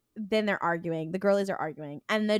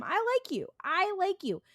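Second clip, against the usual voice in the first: very high, put-on fondness; mock ingratiating; 12 semitones higher than usual. Janitor talking Dean round, mock ingratiating. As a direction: imitate flattery in a high, swooping voice.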